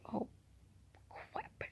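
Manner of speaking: whispered dismay, barely voiced; alarmed whisper. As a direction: whisper it to yourself as if caught out. Retold moment of silent alarm.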